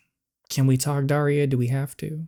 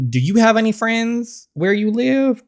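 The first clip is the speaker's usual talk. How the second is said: mocking taunt; 9 semitones higher, 4 dB louder than usual. Mocking the father's loneliness.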